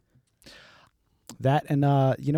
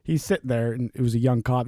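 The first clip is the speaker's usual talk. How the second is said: ordinary delivery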